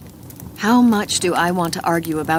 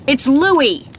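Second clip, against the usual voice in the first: loud high call; shouting out; 7 semitones higher, 7 dB louder than usual. A narrator's reading. Narrator shouting to her partner, loud call. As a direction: call it out so he hears. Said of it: "I shout".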